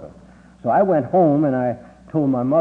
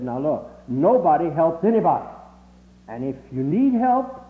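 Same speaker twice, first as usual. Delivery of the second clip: stern teacher; 5 semitones higher, 3 dB louder than usual. Retold or imitated. Teacher scolds the class.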